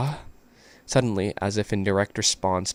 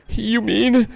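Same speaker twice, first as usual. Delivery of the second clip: high character voice; 7 dB louder than usual. Acted. Voicing a character.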